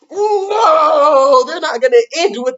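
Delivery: loud, high emphatic no. Real, not acted. Teacher correcting the class with a loud no. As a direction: shut the idea down with a loud no. Teacher answering her own question.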